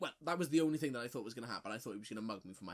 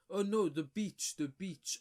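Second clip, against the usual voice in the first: put-on higher, slow, halting foreign accent; mock accented stranger; 8 semitones higher, 7 dB louder, slower than usual. Imitating the stranger pointing to the beach, slow and halting. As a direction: imitate the stranger's broken English. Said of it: "he goes".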